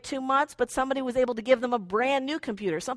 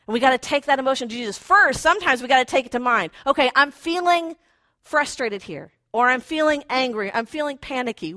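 normal talking voice

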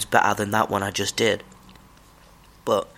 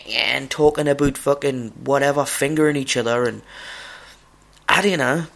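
heated rant; 4 semitones higher than usual. Real, not acted. Heated rant about talkers.

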